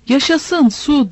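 high, drawn-out cheer; storyteller's restrained hurrah; joyful cry. A narrator's reading. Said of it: "diye bağırdı".

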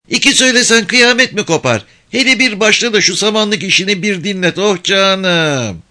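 put-on high, lively little village girl; kız çocuğu sesi. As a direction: play a cheeky young girl. Girl pleads. (tiz sesli köylü kız)

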